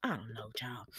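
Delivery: hushed, unvoiced whisper; whispered aside. Real, not acted. Recapper wondering about the killer, whispered aside.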